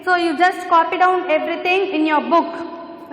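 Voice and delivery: ordinary talk, nothing marked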